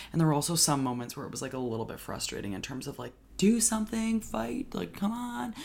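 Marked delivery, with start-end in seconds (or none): 3.4-5.5 high, pleading push; exasperated urging. Retold or imitated. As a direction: urge her on, frustrated. Urging the character.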